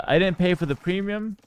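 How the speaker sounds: normal talking voice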